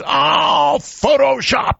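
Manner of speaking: high, sarcastic "oh, Photoshop"; shrill mock scoff at a clear photo; mocking skeptic.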